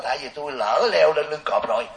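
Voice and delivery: normal talking voice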